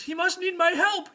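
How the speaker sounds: very high, loud urgent squire; alarmed squire. Acted. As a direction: pitch it up in heroic alarm. Squire imagines master among witches.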